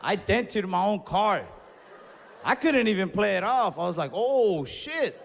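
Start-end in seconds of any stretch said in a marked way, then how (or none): 4.1-5.1 surprised. Retold or imitated. Realizes he hit his car.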